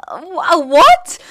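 high, loud, incredulous what; baffled disbelief. Retold or imitated. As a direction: shriek it in disbelief. Baffled by hate over park trips.

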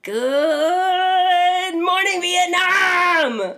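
long booming DJ wake-up call; Robin Williams impression. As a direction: hold morning, blast Vietnam. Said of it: "From the movie".

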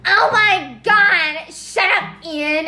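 high, loud mock yell, drawn out; imitated family screaming. Imitating family screaming at each other, mock angry yell. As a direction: yell it like a fed-up sibling, exaggerated. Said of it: "you guys were screaming".

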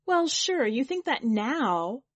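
high, loud, stretched ending; agitated retort. A narrator's reading. Kit rejecting Charlie's calm consent, agitated retort. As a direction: throw it back at him.

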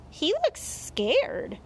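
put-on high, slow little boy; a high, concerned child voice; child's voice. A narrator's reading. Boy pointing out the rabbit to mother, childlike.

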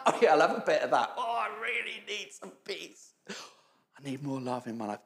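casual picky shopper. Retold or imitated. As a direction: pick and choose like a menu. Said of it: "It's not a".

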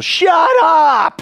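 high, loud yell to shut up; fed-up shouted outburst; exasperated shout. Acted. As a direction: yell it like a sleepless, fed-up neighbour. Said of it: "I'm gonna be like, same like those people".

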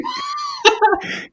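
high shrieking laughter; big laugh. Real, not acted. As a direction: burst out laughing. Laughs after own joke.

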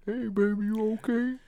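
soft put-on aunt voice; hushed mock-aunt greeting; aunt's voice. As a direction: gently imitate an aunt checking in. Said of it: "that's what she sound like".

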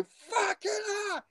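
high-pitched shout of disgust, imitating him; retold horrified yell. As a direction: yell it out like he just opened his eyes on it. Retold reaction to soiled towel.

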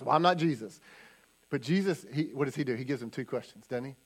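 usual speaking voice

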